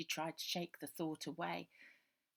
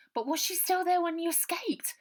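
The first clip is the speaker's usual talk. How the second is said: much higher, louder desperate question; urgent Ida; 12 semitones higher, 11 dB louder than usual. Acted. Ida begging Robert about Bonnie, urgent. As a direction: demand an answer now.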